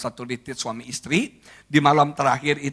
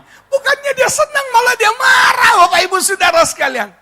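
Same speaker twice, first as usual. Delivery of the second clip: very high, loud comic shout; hammed-up disbelief at full pitch; exasperated outcry; 9 dB louder than usual. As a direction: wail the complaint to the whole crowd. Husband's exasperation at wife's unexpected anger.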